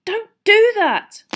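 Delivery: high, loud, drawn-out protest; startled scolding. A narrator's reading. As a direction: shout it at whoever startled you. Startled Harry scolds the elf.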